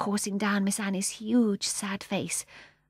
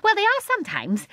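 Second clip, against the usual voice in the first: put-on high, loud, gleeful old woman; gleeful old lady; 7 dB louder than usual. A narrator's reading. Mrs Corrie answering Michael, high gleeful old woman. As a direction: chirp it with glee.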